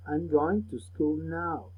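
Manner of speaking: very slow, deliberate model sentence; slow teacher repetition of "I'm going to school now"; said slowly. Shown as a demonstration. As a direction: stretch every word out for the class to copy. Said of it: "Repeat the words slowly".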